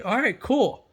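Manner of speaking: ordinary talk, nothing marked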